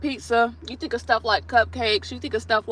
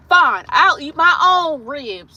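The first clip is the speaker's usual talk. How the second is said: louder, higher, drawn-out huff; mock huffy; 4 semitones higher, 6 dB louder, much slower than usual. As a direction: imitate her indignant, stretched-out retort. Acting out the snubbed classmate.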